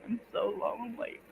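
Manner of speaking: high, soft mock whine; mock lonely. Streamer mock-whining alone on stream. Alone while friend away.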